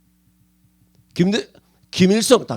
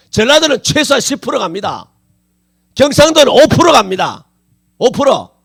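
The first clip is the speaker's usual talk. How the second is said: loud, emphatic pulpit verdict; forceful preaching; 6 semitones higher, 10 dB louder than usual. Real, not acted. Preacher giving regions' odds of heaven, loud and emphatic. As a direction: pronounce each figure with raised, insistent force.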